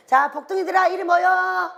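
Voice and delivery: loud, high, cheerful call to gather. Retold or imitated. Grandmother calling her blessed children to come. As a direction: call the kids in happily.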